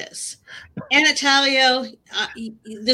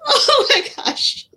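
high, drawn-out laughing gasp; slower than usual. Real, not acted. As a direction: laugh it out slowly, amazed. Host laughs at rooftop interview.

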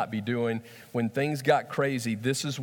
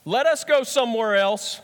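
higher, louder firm answer; Jesus calm but firm; 8 semitones higher, 6 dB louder than usual. Acted. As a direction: say it as a decision already made. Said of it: "Jesus replied".

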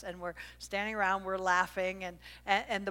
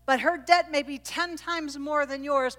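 higher, louder earnest plea; Jesus speaking; 8 semitones higher, 6 dB louder than usual. Retold or imitated. Jesus correcting Simon, earnest and raised. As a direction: make Simon see the truth. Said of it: "Jesus was saying".

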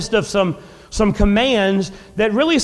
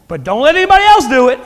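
suddenly louder, fast and pitched up; mock indignant outburst; 5 semitones higher, 8 dB louder, faster than usual. Preacher mocking a driver who cuts in, loud and fast. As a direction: snap it out like the entitled driver.

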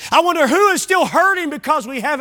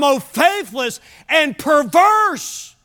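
drawn-out rebuke; 4 semitones higher, much slower than usual. Acted. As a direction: call it out like a grieving prophet. Preacher quoting Jesus' rebuke.

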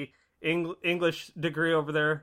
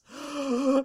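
dramatic gasp; 7 semitones higher than usual. Acted. Dramatic synopsis reading.

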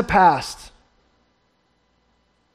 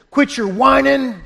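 retold scolding; 5 semitones higher than usual. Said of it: "I raised my voice".

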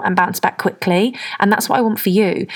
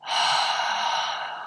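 long relaxed sigh; 8 dB quieter than usual. Real, not acted. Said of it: "Just that feeling of".

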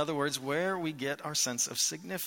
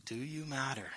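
much softer, hushed and inward; soft repeated question; 4 semitones lower, 10 dB quieter than usual. Real, not acted. Pastor quietly asks the congregation again whether they matter. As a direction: ask it again almost under your breath.

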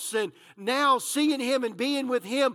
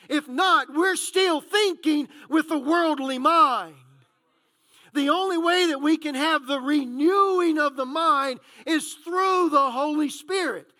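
raised, forceful pulpit voice; impassioned preaching; 4 semitones higher, 4 dB louder than usual. Real, not acted. Preacher urging the congregation on renewing the mind, impassioned. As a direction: drive each point home with high, loud emphasis.

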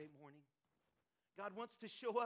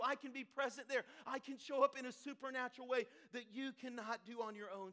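raised, louder sermon voice with emphatic beats; impassioned preaching; 4 semitones higher, 8 dB louder than usual. Acted. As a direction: preach with urgent, insistent force. Preaches at full force.

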